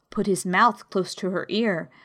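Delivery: plain reading voice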